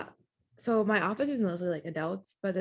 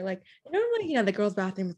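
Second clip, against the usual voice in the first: ordinary talk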